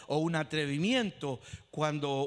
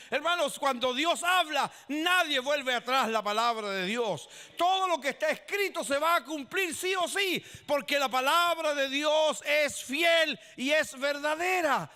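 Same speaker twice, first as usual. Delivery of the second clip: loud, high preaching to the brethren; forceful sermon; fiery preaching; 6 semitones higher, 4 dB louder than usual. Acted. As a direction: proclaim it to the congregation.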